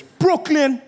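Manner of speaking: high, loud yelled place name; mock shout. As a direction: bellow it out like a wannabe. Shouting random things at airport.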